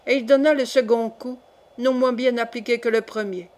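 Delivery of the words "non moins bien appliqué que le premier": ordinary delivery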